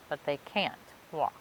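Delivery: ordinary delivery, nothing marked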